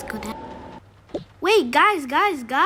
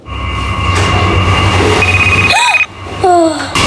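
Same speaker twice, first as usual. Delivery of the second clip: startled gasp; 3 semitones higher, 9 dB louder than usual. Acted. Phone call startle.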